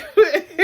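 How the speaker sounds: high, loud burst of laughter. Real, not acted. Amused by the botched accent.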